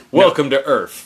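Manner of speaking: lightly voiced action hero line; a hint of [name]; [name] impression. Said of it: "Can I be [name]?".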